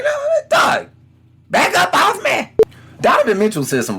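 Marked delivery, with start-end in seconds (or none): 0.0-2.5 sharp refusal. Retold or imitated. Acted exchange over the chicken.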